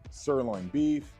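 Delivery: normal talking voice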